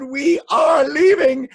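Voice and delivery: high, loud, drawn-out protest; mock wailing shout. A narrator's reading. Townspeople quitting elephant-filled kingdom, high wailing shout. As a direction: wail each word slowly at full volume. Townspeople announce they are fleeing.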